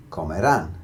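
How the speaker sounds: comerán with emphasis on rán; comerán, landing firmly on rán; final-syllable stress. Shown as a demonstration. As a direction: punch the last syllable of comerán. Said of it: "stressing that very last syllable".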